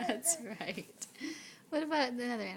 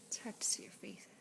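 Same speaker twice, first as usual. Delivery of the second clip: whispering; 3 semitones lower, 7 dB quieter, faster than usual. Real, not acted. Whispered aside.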